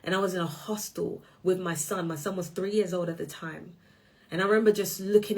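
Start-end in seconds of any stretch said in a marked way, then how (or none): none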